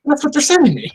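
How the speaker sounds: high-pitched comic bafflement; baffled, squeaky-high complaint; exasperated rant. Acted. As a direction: pitch way up in mock disbelief. Comic rant about emoji messages.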